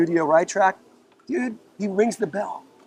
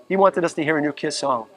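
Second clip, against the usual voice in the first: ordinary talk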